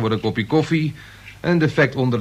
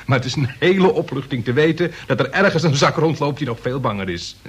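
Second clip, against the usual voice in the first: ordinary delivery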